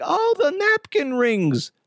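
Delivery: high, slow innocent mom voice; imitating mom. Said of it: "my mom's like".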